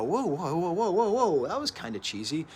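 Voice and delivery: a mock startled outcry at something cheesy; startled whoa. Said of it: "at times I go".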